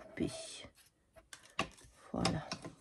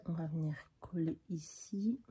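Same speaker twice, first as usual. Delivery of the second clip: soft unvoiced whisper; 2 semitones lower, 6 dB quieter than usual. Real, not acted. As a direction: breathe it under your breath. Chuchote en collant. (chuchoté)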